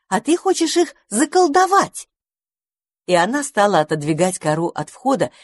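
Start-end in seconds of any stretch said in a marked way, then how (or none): 0.1-2.1 indignant. A narrator's reading. Angry squirrel mother.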